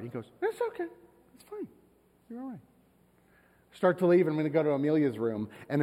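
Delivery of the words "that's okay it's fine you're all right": put-on tiny high child voice, very soft; small, high-pitched mock kid reply; forgiving young son. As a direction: imitate a little kid quietly letting dad off. Young son forgiving dad, retold.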